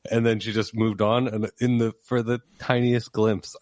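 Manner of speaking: ordinary talk, nothing marked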